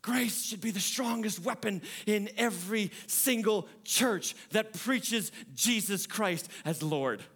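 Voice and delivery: ordinary talk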